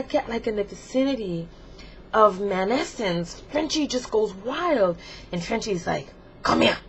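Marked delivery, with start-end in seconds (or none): 6.4-6.8 a hint of a bossy, lustful figure. [name] going wild near a man, demanding. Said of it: "like, a little French accent".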